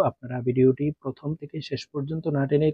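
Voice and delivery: ordinary talk, nothing marked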